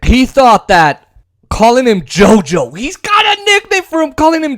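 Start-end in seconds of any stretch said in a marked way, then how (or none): none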